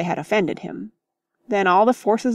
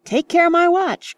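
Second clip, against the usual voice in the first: much higher, louder anxious cry; alarmed cry; 11 semitones higher, 5 dB louder than usual. Acted. Mister Beebe protests as clothes fly, alarmed cry. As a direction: cry out to save the watch. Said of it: "cried Mister Beebe".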